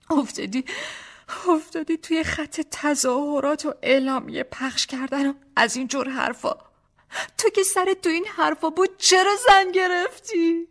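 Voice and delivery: put-on high, accusing young wife, slowing on the last question; reproachful young wife. A narrator's reading. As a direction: confront him about why he married.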